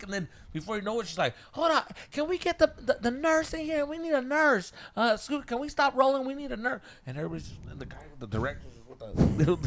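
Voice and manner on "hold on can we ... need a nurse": higher, urgent calling for a nurse; mock the actress calling out for help; lightly imitating alarmed actress. Actress calls the crew for a nurse, higher and urgent.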